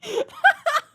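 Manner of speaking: high, loud tearful laughter. Real, not acted. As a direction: laugh until you almost cry. Still laughing at joke.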